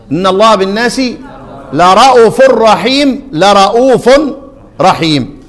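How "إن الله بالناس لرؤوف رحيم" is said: Quran recitation. Shown as a demonstration. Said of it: "أول موضع اللي هي إيه؟". (تلاوة متأنية لرؤوف رحيم)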